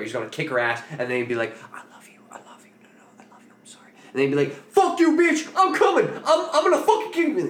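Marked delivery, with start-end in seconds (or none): 1.7-3.9 mock tearful-drunk apology in a whisper; whispered pleading. Drunk rapper apologizing to his girl on the phone, whispered pleading. 4.7-7.4 high, loud mock-drunk screaming; hammed-up raging yell into the phone; screaming threat. Drunk rapper threatening his girl on the phone, imitated screaming. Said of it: "screaming how he's gonna come kill her".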